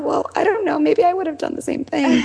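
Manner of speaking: high, airy, laughing reply; laughing shrug. Retold or imitated. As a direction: toss it off with a laugh. Mom voicing polite reply, laughing.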